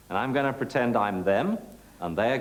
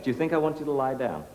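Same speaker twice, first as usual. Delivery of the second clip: ordinary delivery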